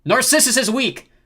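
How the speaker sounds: high, loud, drawn-out jeer; taunting shout. A narrator's reading. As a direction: jeer for the whole crowd to hear. Leo taunting Narcissus before crowd.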